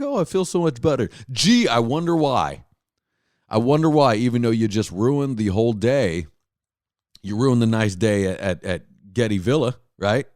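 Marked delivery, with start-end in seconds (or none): 0.0-1.1 put-on higher, sing-song relieved girlfriend; mock girlfriend relief. Girlfriend after finally eating, hammed-up high relief. 1.3-6.3 drip sarcasm on it; sarcastic. Real, not acted. Sarcastic reply.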